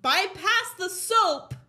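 slow, punched-up storytelling; drawn-out exasperation. Real, not acted. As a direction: stretch every word out, loud and high. Telling her string of distractions.

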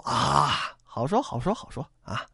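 soft, low sly "sure, sure"; sly agreement. Acted. Boatman softly humoring Song Jiang, slyly agreeing. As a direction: agree while meaning nothing.

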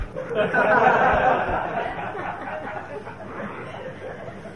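soft breathy laugh, no voice; real laughter. Real, not acted. Preacher laughing with the audience, airy soft laugh. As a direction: laugh quietly under the breath.

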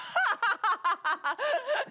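high helpless burst of laughter; surprised laugh attack. Real, not acted. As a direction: laugh hard, caught off guard. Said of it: "That was real".

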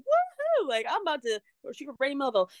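high-pitched whoop, then fast giddy rush; retold excitement. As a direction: re-enact being thrilled with a squealed woohoo, then hurry on. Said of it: "so excited".

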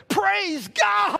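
high, joyful outburst; excited. Acted. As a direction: blurt out praise in delight. Atheist doctor thrilled by healing.

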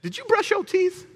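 squeaky mock of the wife catching him at bedtime. Wife quizzing him in bed, hammed-up high voice. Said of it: "and she said".

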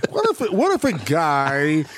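lightly voiced spacey stoner; stoned Jeff Bridges impression. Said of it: "he's like".

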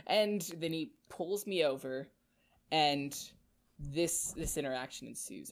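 normal talking voice

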